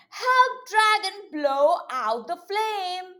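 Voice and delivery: normal talking voice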